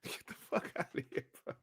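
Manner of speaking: dismissive whisper. Real, not acted. Streamer brushing off a rival channel in a soft whisper. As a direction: mutter it off under your breath.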